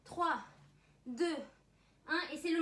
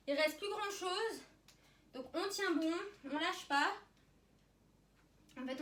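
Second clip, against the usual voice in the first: ordinary delivery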